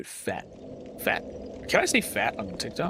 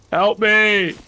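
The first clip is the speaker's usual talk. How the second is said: loud, raised cry for help; calling for help; 4 semitones higher, 7 dB louder than usual. Real, not acted. Gamer in trouble calling for help, loud high shout. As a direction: shout out for rescue.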